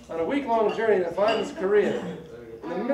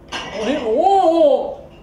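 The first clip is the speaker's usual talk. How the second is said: slow loud drawn-out whoa; overwhelmed gasp of surprise; stunned whoa; 4 semitones higher, 8 dB louder, much slower than usual. Acted. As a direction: stretch the whoa out, loud and amazed. Stunned reaction in joke.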